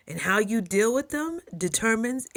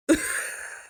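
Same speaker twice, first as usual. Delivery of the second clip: high giggle. Real, not acted. Laughs at own story.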